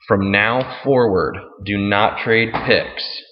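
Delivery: slow and deliberate, each word weighed; slow, firm rule. Real, not acted. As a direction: spell out the rule slowly so nobody misses it. Stressing a new league rule.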